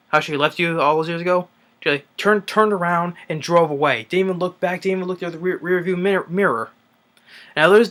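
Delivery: lightly voiced scolding guardian; mock taunting speech. Dwight lecturing Jesse about his mother, lightly voiced taunt.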